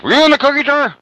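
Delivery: put-on mother confronting her child over the cookie jar; mom catching the kid. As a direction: mimic a suspicious mother. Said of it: "She looks over at you and says".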